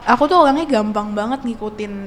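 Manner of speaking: ordinary delivery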